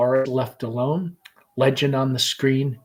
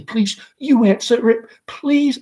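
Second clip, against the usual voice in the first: much higher, pleading; desperately; 10 semitones higher, 5 dB louder than usual. Acted. Laura begs mother to answer, desperate. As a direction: beg in panic. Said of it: "Laura desperately".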